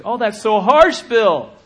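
high, loud whiny protest; hammed-up offended listener; mock complaint.

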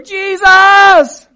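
full-out high, drawn scream. Acted. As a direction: scream it at the top of your lungs. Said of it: "they yelled all the louder".

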